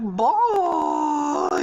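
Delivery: high, loud, drawn-out cry; loud exclamation. Acted. Stampy just done recording, high loud holler. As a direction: blast it out high and stretch it. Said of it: "that fucking loud thing".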